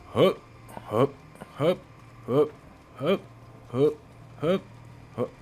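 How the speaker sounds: short, evenly spaced march calls; mock drill count for marching soldiers; marching cadence.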